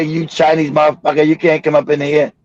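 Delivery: normal talking voice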